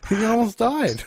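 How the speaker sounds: high, loud disbelief; incredulous. Real, not acted. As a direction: exclaim in disbelief. Incredulous outburst.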